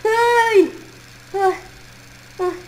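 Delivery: startled scream jolting awake; waking from nightmare. Acted. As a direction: cry out waking in fright. Acorda de um pesadelo. (grito de susto ao acordar)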